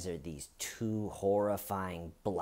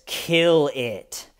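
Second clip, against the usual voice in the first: louder, pressing command; insistent voice; 3 semitones higher, 9 dB louder than usual. Retold or imitated. Voice in his head insisting again, louder. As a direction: push it harder, louder. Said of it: "the voice says".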